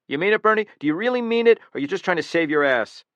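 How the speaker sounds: high, loud desperate demand; pleading challenge. Acted. Killer to the guard, high loud desperate demand. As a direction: push him hard for the truth.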